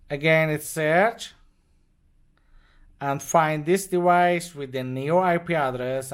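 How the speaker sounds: usual speaking voice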